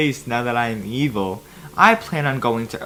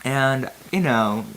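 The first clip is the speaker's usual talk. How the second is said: usual speaking voice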